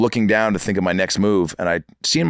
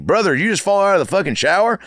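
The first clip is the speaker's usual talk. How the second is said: higher-pitched Midwest military buddy; Midwest accent; 11 semitones higher than usual. Retold or imitated. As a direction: imitate the thick Midwest roommate. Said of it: "real thick, uh, Midwest accent".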